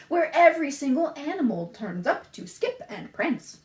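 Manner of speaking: lilting rhymed storybook read; reading to children. A narrator's reading. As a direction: read the rhymes to little listeners. Reads picture book aloud.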